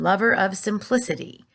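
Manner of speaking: ordinary delivery, nothing marked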